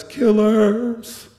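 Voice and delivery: high, drawn-out mock yell; retold yell. Inmates accusing the guards from their cells, imitated yell. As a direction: imitate a distant accusing cry, held long. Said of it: "They started yelling at us".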